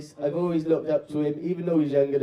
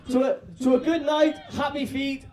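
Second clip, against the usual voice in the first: toast shout; 8 semitones higher than usual. Real, not acted. Toasting the wedding party.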